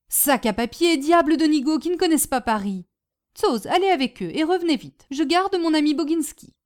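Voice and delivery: lightly voiced cross aunt giving orders; grumble, then order briskly; exasperated Madame Bombec. Aunt annoyed that her nephew can't find his way, exasperated.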